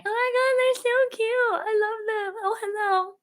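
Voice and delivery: very high-pitched cooing over something cute; mock your own squealing at adorable animals; gushing squeal. Streamer mimicking herself with the cute turtles, gushing squeal.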